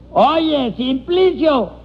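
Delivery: high, stretched call to a deaf man. Acted. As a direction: call his name so a deaf man hears.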